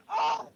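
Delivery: high, loud startled yelp; sudden pained shriek; sharp cry of pain. Acted. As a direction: yelp as it hits her eye. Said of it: "hits her in the eye as she bursts back".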